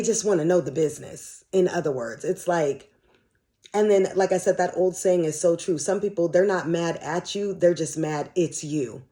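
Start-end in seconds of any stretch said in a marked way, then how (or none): none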